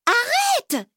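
very high, urgent yell; high-pitched acted shout; crying out. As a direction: yell to halt the mischief. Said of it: "crie Lola".

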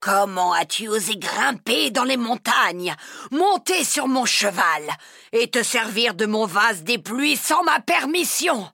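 a mildly loud angry dragon; a lightly voiced furious dragon; mock roar. A narrator's reading. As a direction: scold her like an outraged dragon, storybook style. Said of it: "hurla-t-il".

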